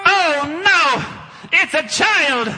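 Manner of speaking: mock terrified cry; mock fright. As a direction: panic over a mere child, overdone. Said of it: "I don't know why I'm scared".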